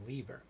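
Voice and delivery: Lieber said as LEE-ber; "Lieber", first syllable like German Lieb; mistaken pronunciation. Shown as a demonstration. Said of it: "for many years I've been calling him".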